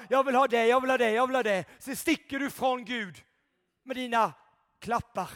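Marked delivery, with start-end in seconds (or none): 0.1-1.7 mock greedy demand. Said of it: "Tryck på knapparna".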